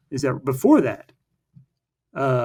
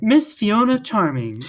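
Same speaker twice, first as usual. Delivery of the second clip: higher, slow swooning read; dreamy diary voice; 7 semitones higher, slower than usual. Retold or imitated. Teen Fiona's diary read dreamily, higher. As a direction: sigh the name like a crush. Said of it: "It's like".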